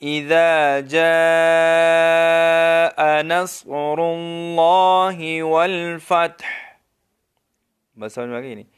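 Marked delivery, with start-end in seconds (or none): 0.0-6.4 slow, drawn-out tajweed chant; Quran recitation. Shown as a demonstration. Teacher recites to students in slow melodic tajweed. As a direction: recite it slowly like a qari. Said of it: "تو کس طرح ٹھیک طریقے سے پڑھیں گے".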